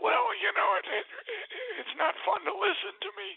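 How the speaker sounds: high, strained, choked rasp; imitated raspy voice. Guest quoting the pastor's damaged voice, strained rasp. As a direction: voice him with paralyzed vocal cords. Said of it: "And he said".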